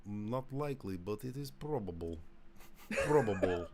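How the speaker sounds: deliberate mock-Russian delivery; Russian accent. Supposed Russian asset hedging his denial, Russian accent. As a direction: weigh each word in a Russian accent. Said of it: "My, my Russian accent".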